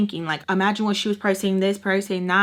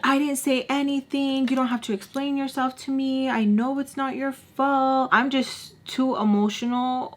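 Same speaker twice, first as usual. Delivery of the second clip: mocking boy; 5 semitones higher than usual. Boy replying to her angry texts, mockingly imitated. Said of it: "the little bitch boy can re-, reply".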